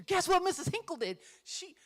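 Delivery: high, loud tattling burst; excited student. Retold or imitated. Student telling co-teacher about his teacher, excited. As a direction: blurt out big news like a thrilled kid. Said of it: "he was so excited".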